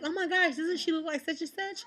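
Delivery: high-pitched mock-excited mimicry; squeaky mocking quote of someone else; mimicked coworker. Imitates coworker making the comparison.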